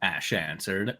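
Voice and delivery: ordinary talk, nothing marked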